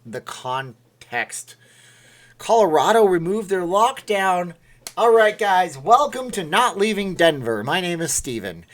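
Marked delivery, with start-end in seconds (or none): 2.4-8.7 loud, high-pitched stand-up host intro; mock show opener. Streamer jokingly opens his show, hammed-up host voice. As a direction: project it like a comic greeting the crowd.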